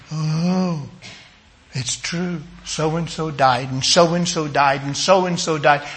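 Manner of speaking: soft, low, resigned thinking aloud; acted despondency, quiet and low; discouraged thinking. Voices a discouraged listener's thinking.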